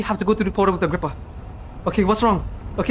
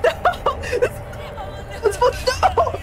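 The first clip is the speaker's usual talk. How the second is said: very high, thin wail; horrified squeal; much slower than usual. Real, not acted. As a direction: voice shooting up in disbelief. Horror at the game's ending.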